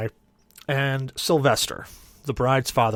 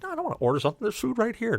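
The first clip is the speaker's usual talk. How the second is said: high retold drunken bickering; whining protest; 11 semitones higher, 4 dB quieter, much faster than usual. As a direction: argue back in a high voice. Drunken bickering over hallway food.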